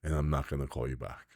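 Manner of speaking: quietly sorry, keeping it low; soft retold apology. Caller apologizing to the agent, said softly and low.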